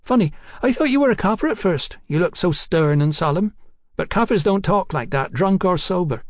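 high-voiced cheeky London girl. A narrator's reading. Hetty teases Mallory.

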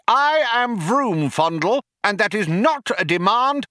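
high-pitched, strident insistence; bawling. A narrator's reading. Younger philosopher bangs the desk, indignant bawling. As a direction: bawl each claim like banging a desk. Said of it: "bawled Vroom Fondle, banging on a nearby desk".

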